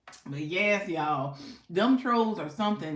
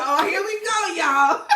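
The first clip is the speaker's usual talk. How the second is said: excited; 9 semitones higher, 6 dB louder than usual. Retold or imitated. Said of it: "I said".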